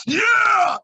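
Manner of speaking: loud, high, drawn-out whoop; mock party yell. Comic imitating volunteer who knows how to party, mock whoop. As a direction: imitate an over-eager partier's big yell. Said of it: "She was like".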